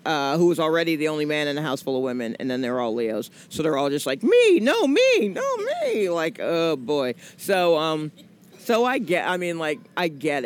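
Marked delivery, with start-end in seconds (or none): 4.2-6.1 high, loud mock clamor of competing sisters; squabbling sisters. Imitating Leo sisters all clamoring for attention. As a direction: pitch it up like sisters talking over each other.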